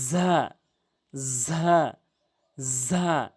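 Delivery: ঝা buzzed firmly with the tongue tip at the teeth; Arabic za. Shown as a demonstration. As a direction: press ঝা out with a strong buzz. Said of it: "তিনটি হরফ".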